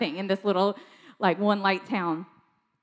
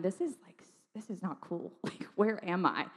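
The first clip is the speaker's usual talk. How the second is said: hushed mock disbelief, much softer; quiet inner thought; 9 dB quieter than usual. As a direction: think it aloud under your breath. Retold private thought in church.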